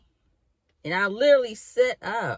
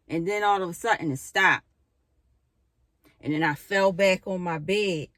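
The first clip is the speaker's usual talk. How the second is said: ordinary talk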